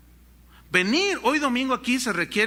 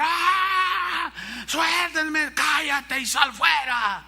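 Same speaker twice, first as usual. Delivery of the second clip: much higher mock scream, slow at the end; girl screaming; 7 semitones higher, much slower than usual. The held girl yelling at her captors, mock scream. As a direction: scream to be let go. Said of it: "la muchacha gritaba".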